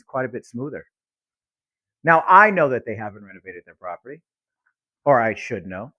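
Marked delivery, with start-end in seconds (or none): none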